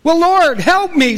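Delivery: acted plea for rescue; pleading for help. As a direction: beg the Lord to save you. Stranded man begs the Lord.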